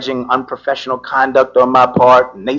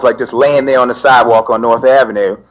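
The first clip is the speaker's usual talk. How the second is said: ordinary talk, nothing marked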